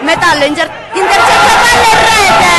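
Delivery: high shouted goal call; excited goal shout. Real, not acted. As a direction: roar the goal to the crowd. Goal call in live match commentary.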